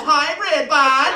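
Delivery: high, loud cheery holler; hammed-up skate kid shout; kid shouting back. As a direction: yell it mid-kickflip as the kid. Acted kid yelling mid-kickflip.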